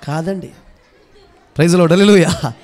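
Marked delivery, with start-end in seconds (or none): 1.6-2.5 loud double praise shout; rally the hall at full voice; congregation call. Real, not acted. Calling congregation to respond. (గట్టిగా స్తుతి నినాదాలు)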